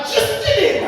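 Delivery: high-pitched sharp call; scolding mother. Retold or imitated. Mother calling her child by full name, scolding. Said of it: "Quando minha mãe falava".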